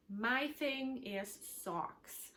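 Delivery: ordinary talk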